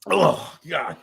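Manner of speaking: ordinary talk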